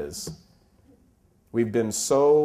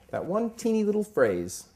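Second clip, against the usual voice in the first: emphatic, pitched up; 12 semitones higher than usual. Real, not acted. Preacher setting up his point, pitched up and emphatic. Repeats phrase, voice climbs.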